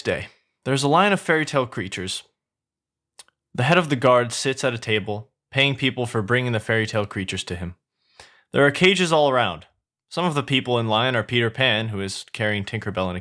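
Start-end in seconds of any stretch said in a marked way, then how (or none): none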